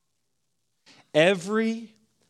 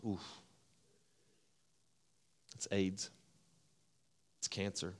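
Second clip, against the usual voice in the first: much softer, heavy dread; grave hushed tone; 10 semitones lower, 17 dB quieter than usual. Retold or imitated. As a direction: hush it down like dreaded news. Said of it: "you can tell in the sound of our voice".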